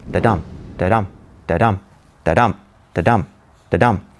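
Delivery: low, evenly spaced da-dum, stressed on DUM; heartbeat rhythm. Shown as a demonstration. Teacher illustrating the heartbeat of English, low steady da-DUM. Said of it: "called the heartbeat of English language".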